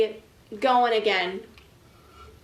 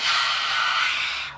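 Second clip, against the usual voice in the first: lion breath. Shown as a demonstration. To the class, a lion-breath huff out. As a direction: let the air out like a lion. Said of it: "like a lion".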